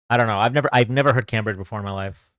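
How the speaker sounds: usual speaking voice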